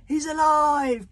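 high, slow, loud cry; drawn-out shout of delight; astonished, delighted bellow. A narrator's reading. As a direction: bellow it in joyful amazement. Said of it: "bellowed Stoic the Vast in astonished delight".

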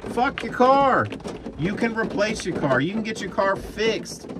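sharp, high, angry rebuke; angry outburst. Real, not acted. Trucker ranting at drivers who stopped, angry high outburst. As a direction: scold them hard for their car.

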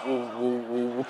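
put-on low, loud, wobbling whoa of a little boy; child's buzzing whoa. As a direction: vibrate the whoa like a happy kid on a buzzing toy. Child voiced with buzzing toy.

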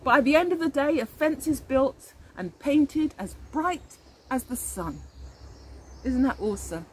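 ordinary delivery

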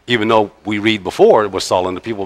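usual speaking voice

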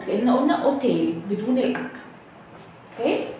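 ordinary delivery

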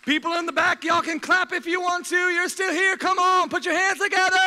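loud high call to the back rows. Real, not acted. Preacher calling to the people in the back to clap, loud and high. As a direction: shout up to the back so they clap.

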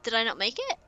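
ordinary talk, nothing marked

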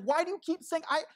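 a mock-angry rant, half laughing by the end; frustrated rant at God. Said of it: "He's like".

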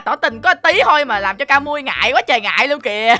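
ordinary delivery

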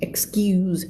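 unvoiced whispered excuse. Shown as a demonstration. Teacher whispering the word while practicing.

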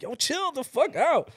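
high, drawn-out "chill out"; retold exasperated plea. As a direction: plead with them to back off, stretching it. Retells telling family to back off.

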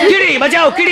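high, hammed-up cry for help; commando's high mock alarm; mock panic. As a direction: call out in comic alarm. Commandos panic as ant bites PM.

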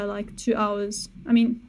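ordinary delivery

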